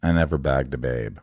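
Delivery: low, flat-toned deadpan confession; quoting the movie nerd, low and deadpan. Retold or imitated. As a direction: say it with no expression at all. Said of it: "It's so deadpan".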